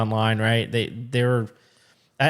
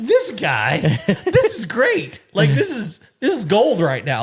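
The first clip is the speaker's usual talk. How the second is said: delighted laugh-talk; 8 semitones higher, slower than usual. Retold or imitated. Delighted at driver obeying.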